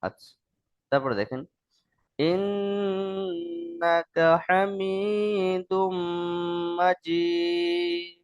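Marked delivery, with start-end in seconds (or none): 2.2-8.2 slow chant with long held nasal m and n; Quranic-style recitation. Shown as a demonstration. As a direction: recite slowly for learners, holding each long sound. (ধীরে টেনে সুরেলা তিলাওয়াত)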